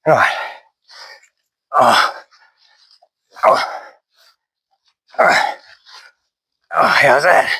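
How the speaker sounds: huffing and puffing between words; breathless after the chainsaw work; out of breath. Real, not acted. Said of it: "Sorry about all the huffing and puffing".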